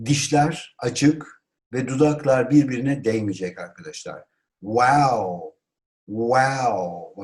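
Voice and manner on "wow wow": WOW drawn out, lips never touching; open-lipped W. Shown as a demonstration. Teacher demonstrates the sound of WOW, lips open. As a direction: round and stretch WOW. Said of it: "bu sesi çıkarmanız gerekiyor".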